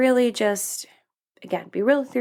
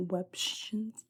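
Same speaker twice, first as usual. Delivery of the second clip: unvoiced whisper, much softer; whispered repeat; 13 dB quieter than usual. Real, not acted. Host mocking their own slip of the tongue, whispered. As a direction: whisper the word back to yourself, drawn out.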